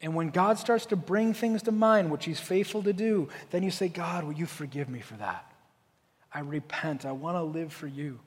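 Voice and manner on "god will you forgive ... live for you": quiet prayer. Pretend. Quoted prayer of repentance.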